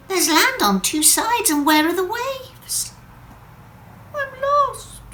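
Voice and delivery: a tiny drop realising she is lost; lost Wendy. Acted. Drop landing in a strange lake, high and anxious.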